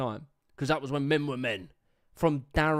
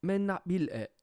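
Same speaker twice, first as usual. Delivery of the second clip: higher-pitched, abandoned go at Geordie; attempted Geordie accent; 5 semitones higher than usual. Commentator copying the clip's speaker, shaky-confidence attempt at Geordie accent. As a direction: try a Geordie accent and do it badly. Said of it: "I can't do the accent".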